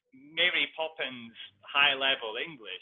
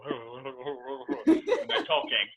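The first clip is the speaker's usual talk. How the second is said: mumbled Scottish imitation; 7 dB louder, much slower than usual. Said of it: "Scottish people go".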